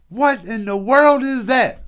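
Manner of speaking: a mother shrieking at a crash in the bathroom; mother screaming. Retold or imitated. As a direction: shriek it like a startled mom at the top of her lungs. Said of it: "My mother screaming at the top of her lungs".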